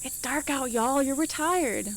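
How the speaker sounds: ordinary talk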